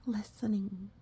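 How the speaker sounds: ordinary delivery, nothing marked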